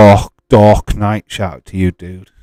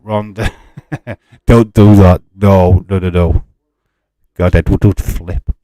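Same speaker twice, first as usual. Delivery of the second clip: normal talking voice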